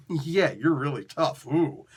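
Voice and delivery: slow, mocking "really tough"; dry sarcastic jab. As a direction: sneer at his toughness. Mocking the beaten character.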